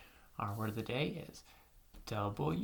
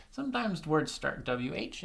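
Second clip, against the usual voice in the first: ordinary talk, nothing marked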